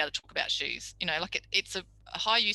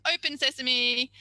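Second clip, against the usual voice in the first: grand magic command; 6 semitones higher, 4 dB louder than usual. Retold or imitated. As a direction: open the door like a showman. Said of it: "And if they said possum".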